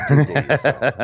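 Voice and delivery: laughing shout. Real, not acted. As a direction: laugh as you repeat "Google yourself".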